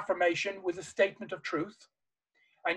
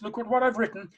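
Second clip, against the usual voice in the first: ordinary talk, nothing marked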